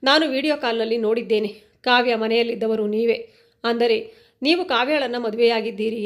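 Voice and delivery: plain reading voice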